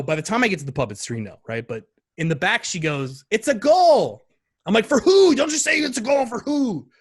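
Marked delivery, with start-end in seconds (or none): 3.3-4.2 put-on high, loud child's announcement; imitated child's excited cry; excited little girl. Daughter shouting from back seat. 4.9-6.9 high, loud urgent demand; retold excited exasperation; frantic dad. Acts out his frantic demand.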